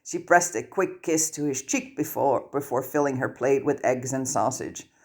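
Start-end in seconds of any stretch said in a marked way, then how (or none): none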